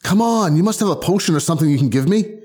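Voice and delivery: acted, exasperated coaxing; pleading. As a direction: beg a reluctant friend for help. Begging friend for a potion.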